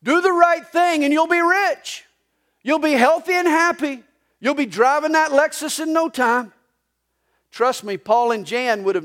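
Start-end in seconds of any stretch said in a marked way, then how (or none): 0.0-6.5 TV preacher. Retold or imitated. Pastor mocking TV preachers for the congregation, hammed-up preacher pitch. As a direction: sell it like a slick televangelist. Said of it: "Listen to most T V preachers".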